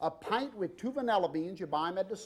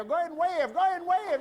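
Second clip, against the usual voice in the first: excited coaxing; 12 semitones higher, 4 dB louder, faster than usual. Real, not acted. Urging sister in audience.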